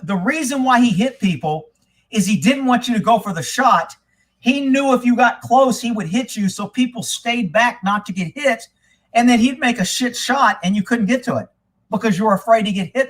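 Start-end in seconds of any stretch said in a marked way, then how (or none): none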